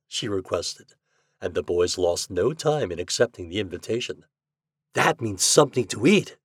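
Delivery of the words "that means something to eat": ordinary delivery, nothing marked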